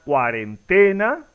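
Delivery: "cuarentena" stressed on TE, cuarenTÉna, rising; questioning "cuarenTEna". Shown as a demonstration. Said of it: "Es la pronunciación correcta".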